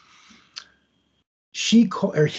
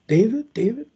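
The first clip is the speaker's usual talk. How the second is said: calling out; 7 semitones higher than usual. Retold or imitated. Calling into empty apartment.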